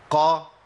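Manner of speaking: قا with a clear open vowel; open qaf demo. Shown as a demonstration. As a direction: say قا with the fatha. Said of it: "القاف المفتوحة".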